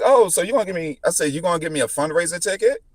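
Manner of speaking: high, fast mock-innocent question; sarcastic retort. Driver teasing the cop about a fundraiser ticket, sarcastic. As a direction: needle the officer with a sly jab. Mocking the cop at traffic stop.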